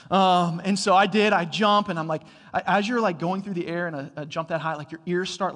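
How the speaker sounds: normal talking voice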